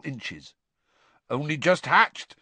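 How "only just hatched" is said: lightly voiced proud giant; a hint of a beaming gamekeeper; proud Hagrid. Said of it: "said Hagrid proudly".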